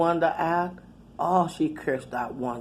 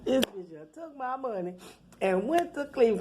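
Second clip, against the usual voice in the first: softer, under the breath; muttered disbelief; 5 semitones higher, 5 dB quieter than usual. Retold or imitated. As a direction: mutter it to yourself, stunned. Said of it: "I was like".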